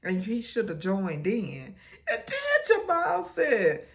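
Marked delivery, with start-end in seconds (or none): 2.1-3.9 high laughing squeal while telling it. Real, not acted. Recapping the show, laughing high and loud.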